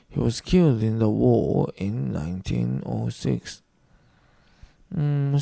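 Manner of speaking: ordinary delivery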